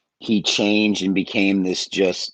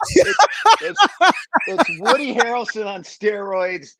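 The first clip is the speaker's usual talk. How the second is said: laughing; 12 semitones higher, 4 dB louder than usual. Real, not acted.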